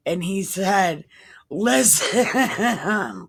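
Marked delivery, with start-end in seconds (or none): 1.5-3.3 word breaking into real laughter; cracking up. Real, not acted. Reader voicing Jesus's reply to the soldiers, cracking up. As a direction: start the quote and dissolve into laughs. Cracks up at own irreverent paraphrase.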